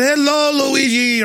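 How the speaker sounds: high-pitched mock Italian greeting; fake Italian accent. Diner hailing an Italian restaurateur, in a fake Italian accent. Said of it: "some kind of fake Italian accent".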